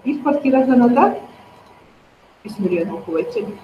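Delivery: normal talking voice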